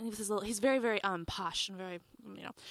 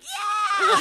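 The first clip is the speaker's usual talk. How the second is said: high, loud whooping shout; hammed-up rock-fan yell; crazy rocker American; 7 semitones higher, 6 dB louder than usual. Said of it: "I'm like this crazy rocker American".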